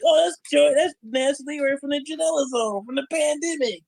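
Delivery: lightly voiced fan chatter; mock fans. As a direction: play fans sneering at his past. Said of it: "some people be like".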